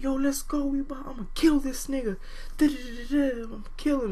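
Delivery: imitated angry threats, pitched high; high, worked-up retelling of a dad's rant; dad going wild. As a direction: act out a father riled up for revenge. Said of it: "he's going wild".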